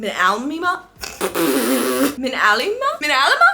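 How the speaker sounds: trying out Minalima as MIN-al-ee-ma, rising like a question; testing Minalima three ways, each a question; unsure pronunciation. Real, not acted. Vlogger unsure how to say a designer name, guessing aloud. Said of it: "I'm gonna butcher this name".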